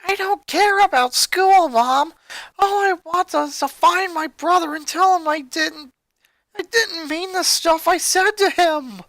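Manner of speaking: high, loud, desperate plea; yelling. Acted. Lynn yells at her mother from the back seat. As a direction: shout it out through guilt. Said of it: "Lynn yelled".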